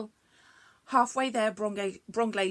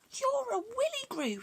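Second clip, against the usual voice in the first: stunned repeat. Acted. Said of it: "he repeated".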